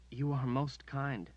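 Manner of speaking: a hint of nervous stammering, slow; mild stammer. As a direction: stumble slightly over the words, slowly. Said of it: "the Duchess stammered".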